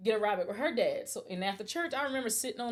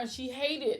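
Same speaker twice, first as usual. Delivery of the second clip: ordinary talk, nothing marked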